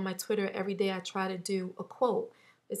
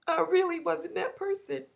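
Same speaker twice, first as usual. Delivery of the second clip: real tearful voice, pitched much higher; words forced out through sobs; crying; 8 semitones higher than usual. Real, not acted. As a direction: voice cracking as she fights back tears. Still crying about her fear.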